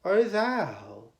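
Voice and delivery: usual speaking voice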